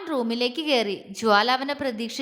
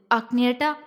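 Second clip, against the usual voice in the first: ordinary delivery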